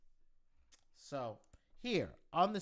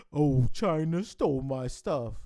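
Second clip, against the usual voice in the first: mocking whine; 6 dB louder than usual. Mocking whiny tech boss quote.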